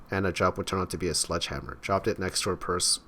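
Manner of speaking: ordinary talk, nothing marked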